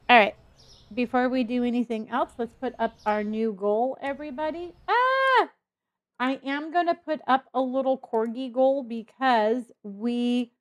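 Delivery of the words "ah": sudden high yelp of surprise; a quick, sharp "ah" as something catches you off guard; startled squeal. Real, not acted. Sudden cry while setting goal.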